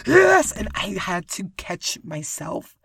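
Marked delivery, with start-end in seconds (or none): 0.1-0.5 high, loud exclaimed yes; excited yes. Retold or imitated. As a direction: blurt it out with delight. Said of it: "and I was like".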